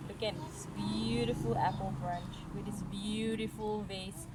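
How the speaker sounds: ordinary delivery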